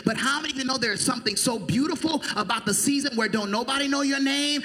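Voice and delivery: ordinary delivery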